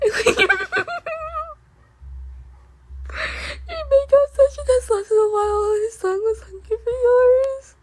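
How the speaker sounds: barely holding it together; giggly reading. Real, not acted. Reader giggling through spicy line.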